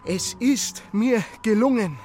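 breathless. Acted. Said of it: "Atemlos kam er an".

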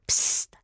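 sharp voiceless psst; urgent hissed shush; sudden shush. A narrator's reading. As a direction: hush them suddenly. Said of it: "machte die Frau plötzlich".

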